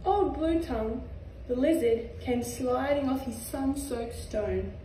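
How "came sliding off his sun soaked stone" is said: ordinary delivery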